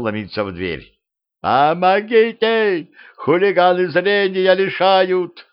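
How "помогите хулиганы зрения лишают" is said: high, slow, wailing cry for help; wild yell for help. Acted. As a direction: howl it like a thug in a panic. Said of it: "с диким воплем".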